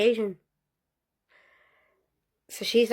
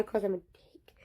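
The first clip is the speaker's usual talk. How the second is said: guilty whisper; sheepish whispered admission; whispered aside; 7 dB quieter than usual. Real, not acted. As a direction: confess it under your breath.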